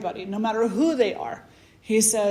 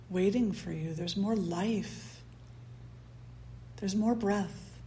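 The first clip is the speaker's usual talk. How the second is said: guided meditation; 7 dB quieter than usual. Acted. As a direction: ease them into the breath, gently.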